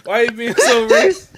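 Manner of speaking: high, loud, half crying with laughter; laughing outrage. Real, not acted. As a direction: protest while cracking up. Mock outrage at the impression.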